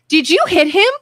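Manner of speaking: retold teacher's sharp question to a child; mad teacher. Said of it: "she looks mad".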